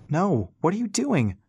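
high-pitched, urgent cry of protest; alarmed shout. A narrator's reading. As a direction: shout in alarm over howling wind. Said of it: "Rass shouted into the howling wind".

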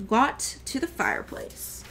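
usual speaking voice

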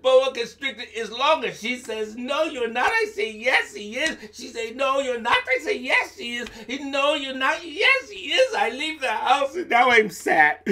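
yes-no squabble with the wife; acted quarrel. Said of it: "Anansi said".

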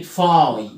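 "فاي" drawn out as a long "fai"; slow "فاي" with a long eye sound; long I demo. Shown as a demonstration. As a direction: stretch the vowel glide in "فاي". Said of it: "التلات حروف I".

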